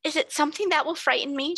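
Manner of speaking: high, hesitant frightened question; timorous. A narrator's reading. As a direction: ask it timidly, afraid of the answer. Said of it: "she asked timorously".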